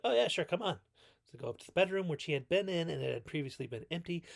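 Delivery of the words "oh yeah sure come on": breezy mock welcome. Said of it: "It's like".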